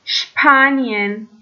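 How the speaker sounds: شپانین said very slowly with shp at the start; German sp as shp. Shown as a demonstration. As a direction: stretch شپانین out, clear shp.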